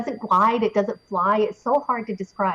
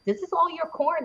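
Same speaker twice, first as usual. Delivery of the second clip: pitched-up amazed question; 8 semitones higher, 4 dB quieter than usual. Retold or imitated. Asking the farmer about his corn, pitched up in amazement. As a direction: lift it high in disbelief. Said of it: "I'm like".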